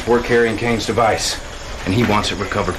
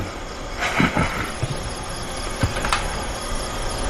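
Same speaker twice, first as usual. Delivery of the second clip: long, quiet breathy chuckle; smug laugh; 7 dB quieter than usual. Acted. Officer gloating to the Commander about Killian, quiet smug chuckle. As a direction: laugh under your breath, pleased with yourself. Gloating after taking credit.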